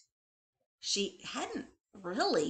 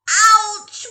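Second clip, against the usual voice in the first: high, loud yelp of pain; a high yelp from a hurt foot; loud ouch; 13 semitones higher, 19 dB louder than usual. A narrator's reading. Bunny hurts foot.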